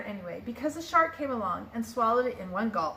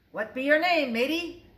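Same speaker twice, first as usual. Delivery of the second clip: pirate voice; 4 semitones higher, 4 dB louder than usual. A narrator's reading. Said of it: "the head pirate asked".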